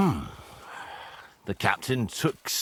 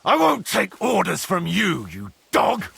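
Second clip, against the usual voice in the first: raised, higher-pitched refusal; angry defiance; 9 semitones higher, 4 dB louder than usual. Acted. Refusing orders from an enemy, angry defiance. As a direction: throw the insult back loudly. Defiant insult before fight.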